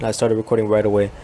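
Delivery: usual speaking voice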